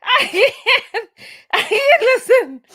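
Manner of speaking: high words broken by laughter; real, uncontrollable laughter; helpless laughing. Real, not acted. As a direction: try to speak and crack up. Still in a helpless laughing fit.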